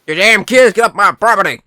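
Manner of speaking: high, loud cranky mock yell; screaming old lady. Old lady chasing kids off her land, hammed-up high yell. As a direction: holler like an old lady at trespassers. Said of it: "the old lady's screaming".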